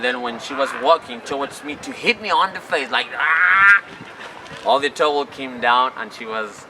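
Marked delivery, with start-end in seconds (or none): 3.1-3.8 mock yell. Mom charging to hit him.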